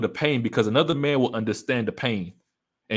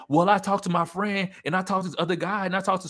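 pitched-up mock woman justifying herself; imitating a woman; 8 semitones higher than usual. A woman justifying her breakup to people, light mock voice. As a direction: list the friends in a lightly raised mock voice. Woman listing who she consulted.

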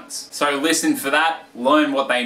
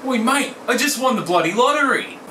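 much higher, thrilled shout of news; excited cheer; 10 semitones higher than usual. Acted. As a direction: blurt out the big win to your mate. Mate celebrating a lottery win.

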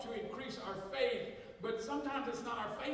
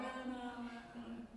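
softer, half-hearted no's; mock mumbled refusal; 7 dB quieter than usual. Husband quietly turning down Mexican to wife, mildly mumbled. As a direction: grumble the refusal under your breath. Himself refusing in retold quarrel.

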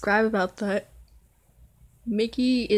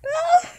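short, very high yelp; sudden squeal of fright; startled scream; 6 dB louder than usual. Real, not acted. Startled while hearing stuff.

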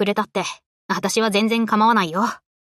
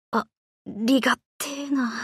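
grateful, nearly in tears; quietly moved thanks; choked up; 6 dB quieter than usual. Acted. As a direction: voice catching with emotion, soft. Moved by the admiral's gift.

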